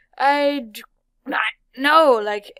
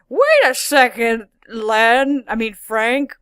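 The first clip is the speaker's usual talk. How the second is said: loud, indignant mock question; hammed-up puzzled outburst; acted cartoon character; 4 semitones higher, 6 dB louder, slower than usual. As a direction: play a character loudly demanding an answer. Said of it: "then there's one episode".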